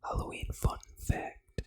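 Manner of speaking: ordinary talk, nothing marked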